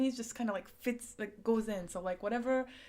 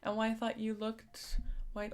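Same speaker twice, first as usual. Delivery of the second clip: normal talking voice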